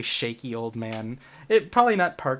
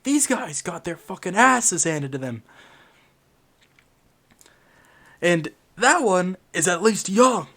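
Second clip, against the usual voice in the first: put-on much higher, loud, ranting old-timer; angry old man; 5 semitones higher, 6 dB louder than usual. Shaky old man rants at the group, high and loud. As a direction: shout like a fervent old veteran.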